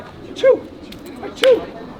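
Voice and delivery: high breathy fake sneeze.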